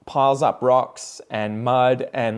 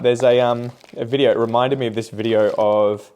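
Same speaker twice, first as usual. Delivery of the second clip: ordinary talk, nothing marked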